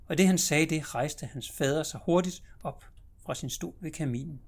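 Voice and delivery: usual speaking voice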